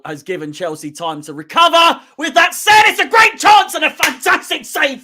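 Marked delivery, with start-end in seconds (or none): 2.2-5.0 loud, high burst of praise for the keeper; excited shout. Real, not acted. Fan shouting at the TV over a great save, excited. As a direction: yell it out, thrilled by the save.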